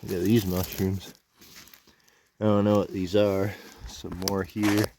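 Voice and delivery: talking around a dip in the lip. Real, not acted. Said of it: "If I sound weird, it's 'cause I got a dip in my mouth".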